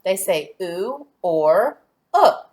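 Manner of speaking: oo and uh drawn out slowly as clear vowel sounds; slow teacher demo of vowel sounds. Shown as a demonstration. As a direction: model oo and uh slowly for the class. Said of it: "what sound do those make?".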